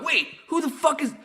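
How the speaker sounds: high loud what-is-this cry; amazed outburst. Retold or imitated. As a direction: blurt it out in shock. Retold outburst at the radio.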